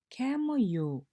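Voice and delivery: high, loud call, "Ke mo ga"; lively teacher calling to students; call-and-response teacher call. Shown as a demonstration. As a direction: call out the prompt to the class. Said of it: "you say, I say".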